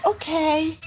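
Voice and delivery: put-on high, small child voice; agreeable little girl. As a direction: answer like a sweet, obliging child.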